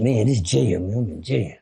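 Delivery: low and hushed, a man realizing he is locked up; dejected mutter. Retold or imitated. First night in the cell, telling himself he is really in jail, low and hushed. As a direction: sink into it, voice dropping.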